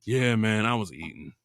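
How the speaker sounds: low reply dropping off softly; low-key admission. Retold or imitated. Retelling the friend who was on the couch, low-key. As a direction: imitate a deflated, offhand confession.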